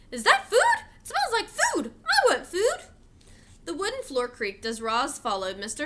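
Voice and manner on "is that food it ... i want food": very high, loud, eager dog voice; dog chasing the groceries, high excited yapping; barking dog. A narrator's reading. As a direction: yap it out like a hungry dog.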